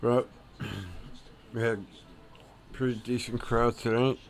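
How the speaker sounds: ordinary delivery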